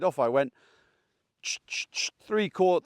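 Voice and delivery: usual speaking voice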